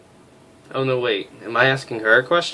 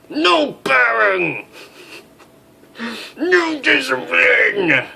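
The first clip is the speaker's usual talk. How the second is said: higher, slow drawn-out disdain; 6 dB louder, much slower than usual. Acted. Major scorning a cheery officer, slow disdain. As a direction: stretch each word in contempt.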